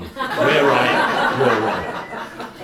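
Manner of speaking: loud, laughing gloat; cheeky victorious laugh; laughing triumph. Retold or imitated. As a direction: crow the win through laughter. Boss's triumphant joke punchline.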